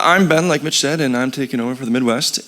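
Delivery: ordinary talk, nothing marked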